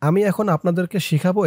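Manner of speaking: ordinary talk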